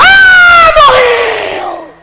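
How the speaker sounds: high, loud anguished wail of grief; wailing mother. Acted. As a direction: cry it out as a grieving mother at the door. Wife wailing over the dead girl.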